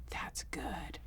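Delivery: soft unvoiced whisper; whisper back. Bride whispering back to the shy flower girl. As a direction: whisper it gently to the shy child. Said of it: "I remember going like".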